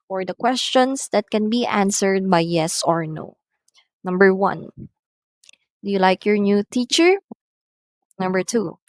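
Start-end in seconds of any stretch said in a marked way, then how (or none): 4.8-7.2 yes-no question lifting on "teacher"; rising intonation. Shown as a demonstration. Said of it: "are going up".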